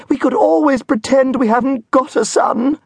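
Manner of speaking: very high, shrill-pitched melodramatic wail; over-the-top wail of a snobbish mother; hammed-up wailing mother. A narrator's reading. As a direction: wail it out like a mother fearing scandal. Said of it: "Missus Elliot wailed".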